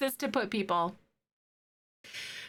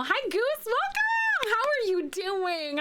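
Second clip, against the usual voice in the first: cheery greeting; 11 semitones higher, slower than usual. Real, not acted. Greeting a chat viewer brightly.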